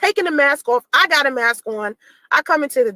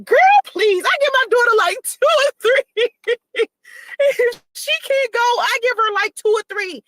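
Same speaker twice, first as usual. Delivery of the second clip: higher, airy nurse impression, breezy and dismissive; mimicking the nurse; 9 semitones higher than usual. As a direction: play the nurse brushing it off, "Girl, please". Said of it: "She was like".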